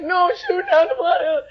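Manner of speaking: high excited cry; a near-shouting, joyful high reply. Retold or imitated.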